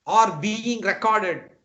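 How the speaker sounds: normal talking voice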